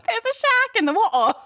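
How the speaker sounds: high, hammed-up Australian-cockney imitation; mock cockney accent. Co-host mimics host's bad accent, high mock cockney. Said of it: "I go from Australian to cockney".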